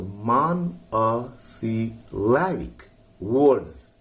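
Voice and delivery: मान अ सी लैबिक words drawn out slowly, the लैब syllable pushed hard; stress on lab. Shown as a demonstration. Teacher modelling the stress for students, slow and deliberate. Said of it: "लैब पर जोर लगाना है".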